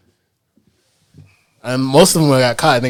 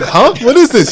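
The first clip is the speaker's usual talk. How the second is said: loud, high mock confusion; hammed-up bewilderment; baffled; 12 semitones higher, 10 dB louder than usual. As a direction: play the puzzled customer, pitched up. Acts the baffled skit customer.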